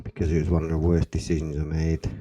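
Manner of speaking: normal talking voice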